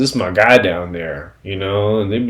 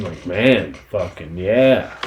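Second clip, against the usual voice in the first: usual speaking voice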